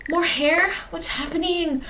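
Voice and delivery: high, bewildered alarm; acted panic, pitched high; horrified. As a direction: question it in mounting horror. Acted horror at vomiting hair.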